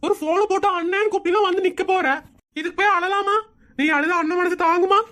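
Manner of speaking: doting big brother. Acted. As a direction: comfort the crying sister as her brother. Voices doting brother in skit.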